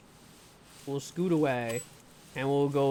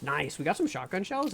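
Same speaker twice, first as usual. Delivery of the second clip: ordinary talk, nothing marked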